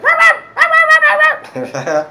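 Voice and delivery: high, loud yapping barks; frantic yappy dog impression; imitated dog barking. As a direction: bark like a startled dog. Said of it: "like, barking and shit".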